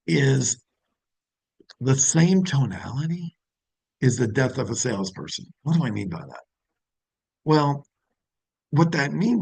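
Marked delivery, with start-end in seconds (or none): none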